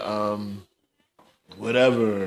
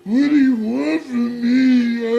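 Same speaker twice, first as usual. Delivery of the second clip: much higher, louder mocking sob; exaggerated mock wail, cracking into cries; mock crying; 13 semitones higher, 6 dB louder than usual. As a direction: ham up a blubbering plea for help. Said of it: "For you to be crying".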